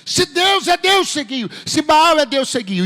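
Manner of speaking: high, drawn-out prophetic shout; Elias shouting. Acted. Preacher acting out Elijah challenging the people, shouted high. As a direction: cry the challenge out, easing at the end. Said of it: "eu vejo ele gritando".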